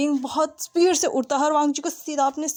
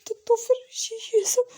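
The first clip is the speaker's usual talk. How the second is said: high, choked near-crying stammer; 10 semitones higher than usual. Acted. Wang ji chokes out his words to Wing. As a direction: voice barely getting out in shock. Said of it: "आवाज़ जैसे बाहर ही नहीं निकल रही थी".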